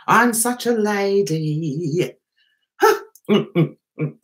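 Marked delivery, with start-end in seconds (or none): 0.1-2.1 slow, stretched last word; drawn-out repeat. Pretend. Host to viewers about being such a lady, drawn out. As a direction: linger long on the end of "lady".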